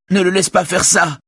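very high, frantic plea; acted terrified woman's appeal; panicked cry. A narrator's reading. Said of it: "s'écrie Véronique prise de panique".

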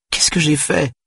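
put-on very high, tearful child's plea; sobbing. A narrator's reading. Daughter asking her father what she did, sobbing. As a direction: wail it as a distressed little girl. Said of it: "avait-elle sangloté".

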